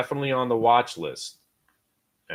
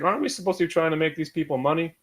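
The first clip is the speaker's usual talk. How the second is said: higher, quick, earnest question; retold naive question; 6 semitones higher, faster than usual. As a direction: ask it like a naive rookie. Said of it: "And I was like".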